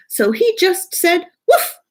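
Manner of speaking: put-on high, eager child reading; playful kid reader. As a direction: bark the woof out for fun. Student reading dog book.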